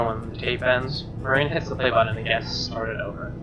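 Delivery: plain reading voice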